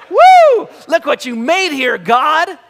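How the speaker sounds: loud, high whoop to God; overjoyed Adam shouting; excited cheer. Retold or imitated. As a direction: cheer at the top of your lungs. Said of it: "it's more like Adam going".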